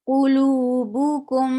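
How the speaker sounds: Qulubukum slowly, each syllable drawn out; slow, careful Quran recitation of Qulubukum; recitation demo. Shown as a demonstration. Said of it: "Clearly from your throat".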